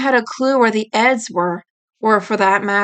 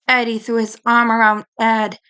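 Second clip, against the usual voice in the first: ordinary delivery, nothing marked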